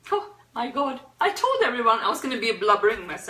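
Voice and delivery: real tears breaking through, words spilling out fast; blubbering mess. Real, not acted. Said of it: "now I'm a blubbering mess".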